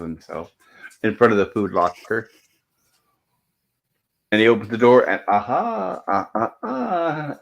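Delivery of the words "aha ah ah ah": high, slow, drawn-out imitated aha; Gilligan's gleeful aha. Opening the food locker at midnight, a mock gleeful aha. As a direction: act out a delighted discovery, stretching each ah. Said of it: "says Gilligan".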